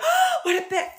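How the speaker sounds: very high-pitched scandalized imitation; mock shocked. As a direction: imitate a pearl-clutcher, pitch shooting up. Mocks people's gasping shocked reaction.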